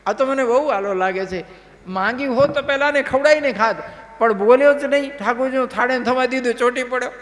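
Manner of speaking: amused sage. Acted. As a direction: tease her kindly like an amused guru. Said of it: "દાંત કાઢે છે". (હસતા ગુરુનો ઊંચો, પ્રેમાળ અવાજ)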